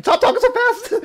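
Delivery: high loud laughing shout; amused high yell at a chatty character. Real, not acted. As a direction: yell it at the screen through a laugh. Yells at fast game dialogue.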